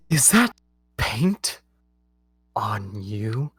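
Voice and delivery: horrified discovery; shocked queen. Acted. Queen spotting paint on the card, gasping then whispering.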